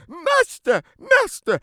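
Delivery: very high, thin cry for help; harp calling. Acted. Golden harp calling its master while stolen, high cry. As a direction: squeal for your master. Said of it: "my harp is calling".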